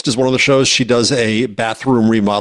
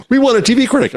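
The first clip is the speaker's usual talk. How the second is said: excited producer voice; 12 semitones higher, faster than usual. Retold or imitated. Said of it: "retold TV producers".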